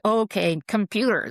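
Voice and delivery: retold rallying call; called-out cue. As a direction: call it out like the signal to start. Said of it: "when I say".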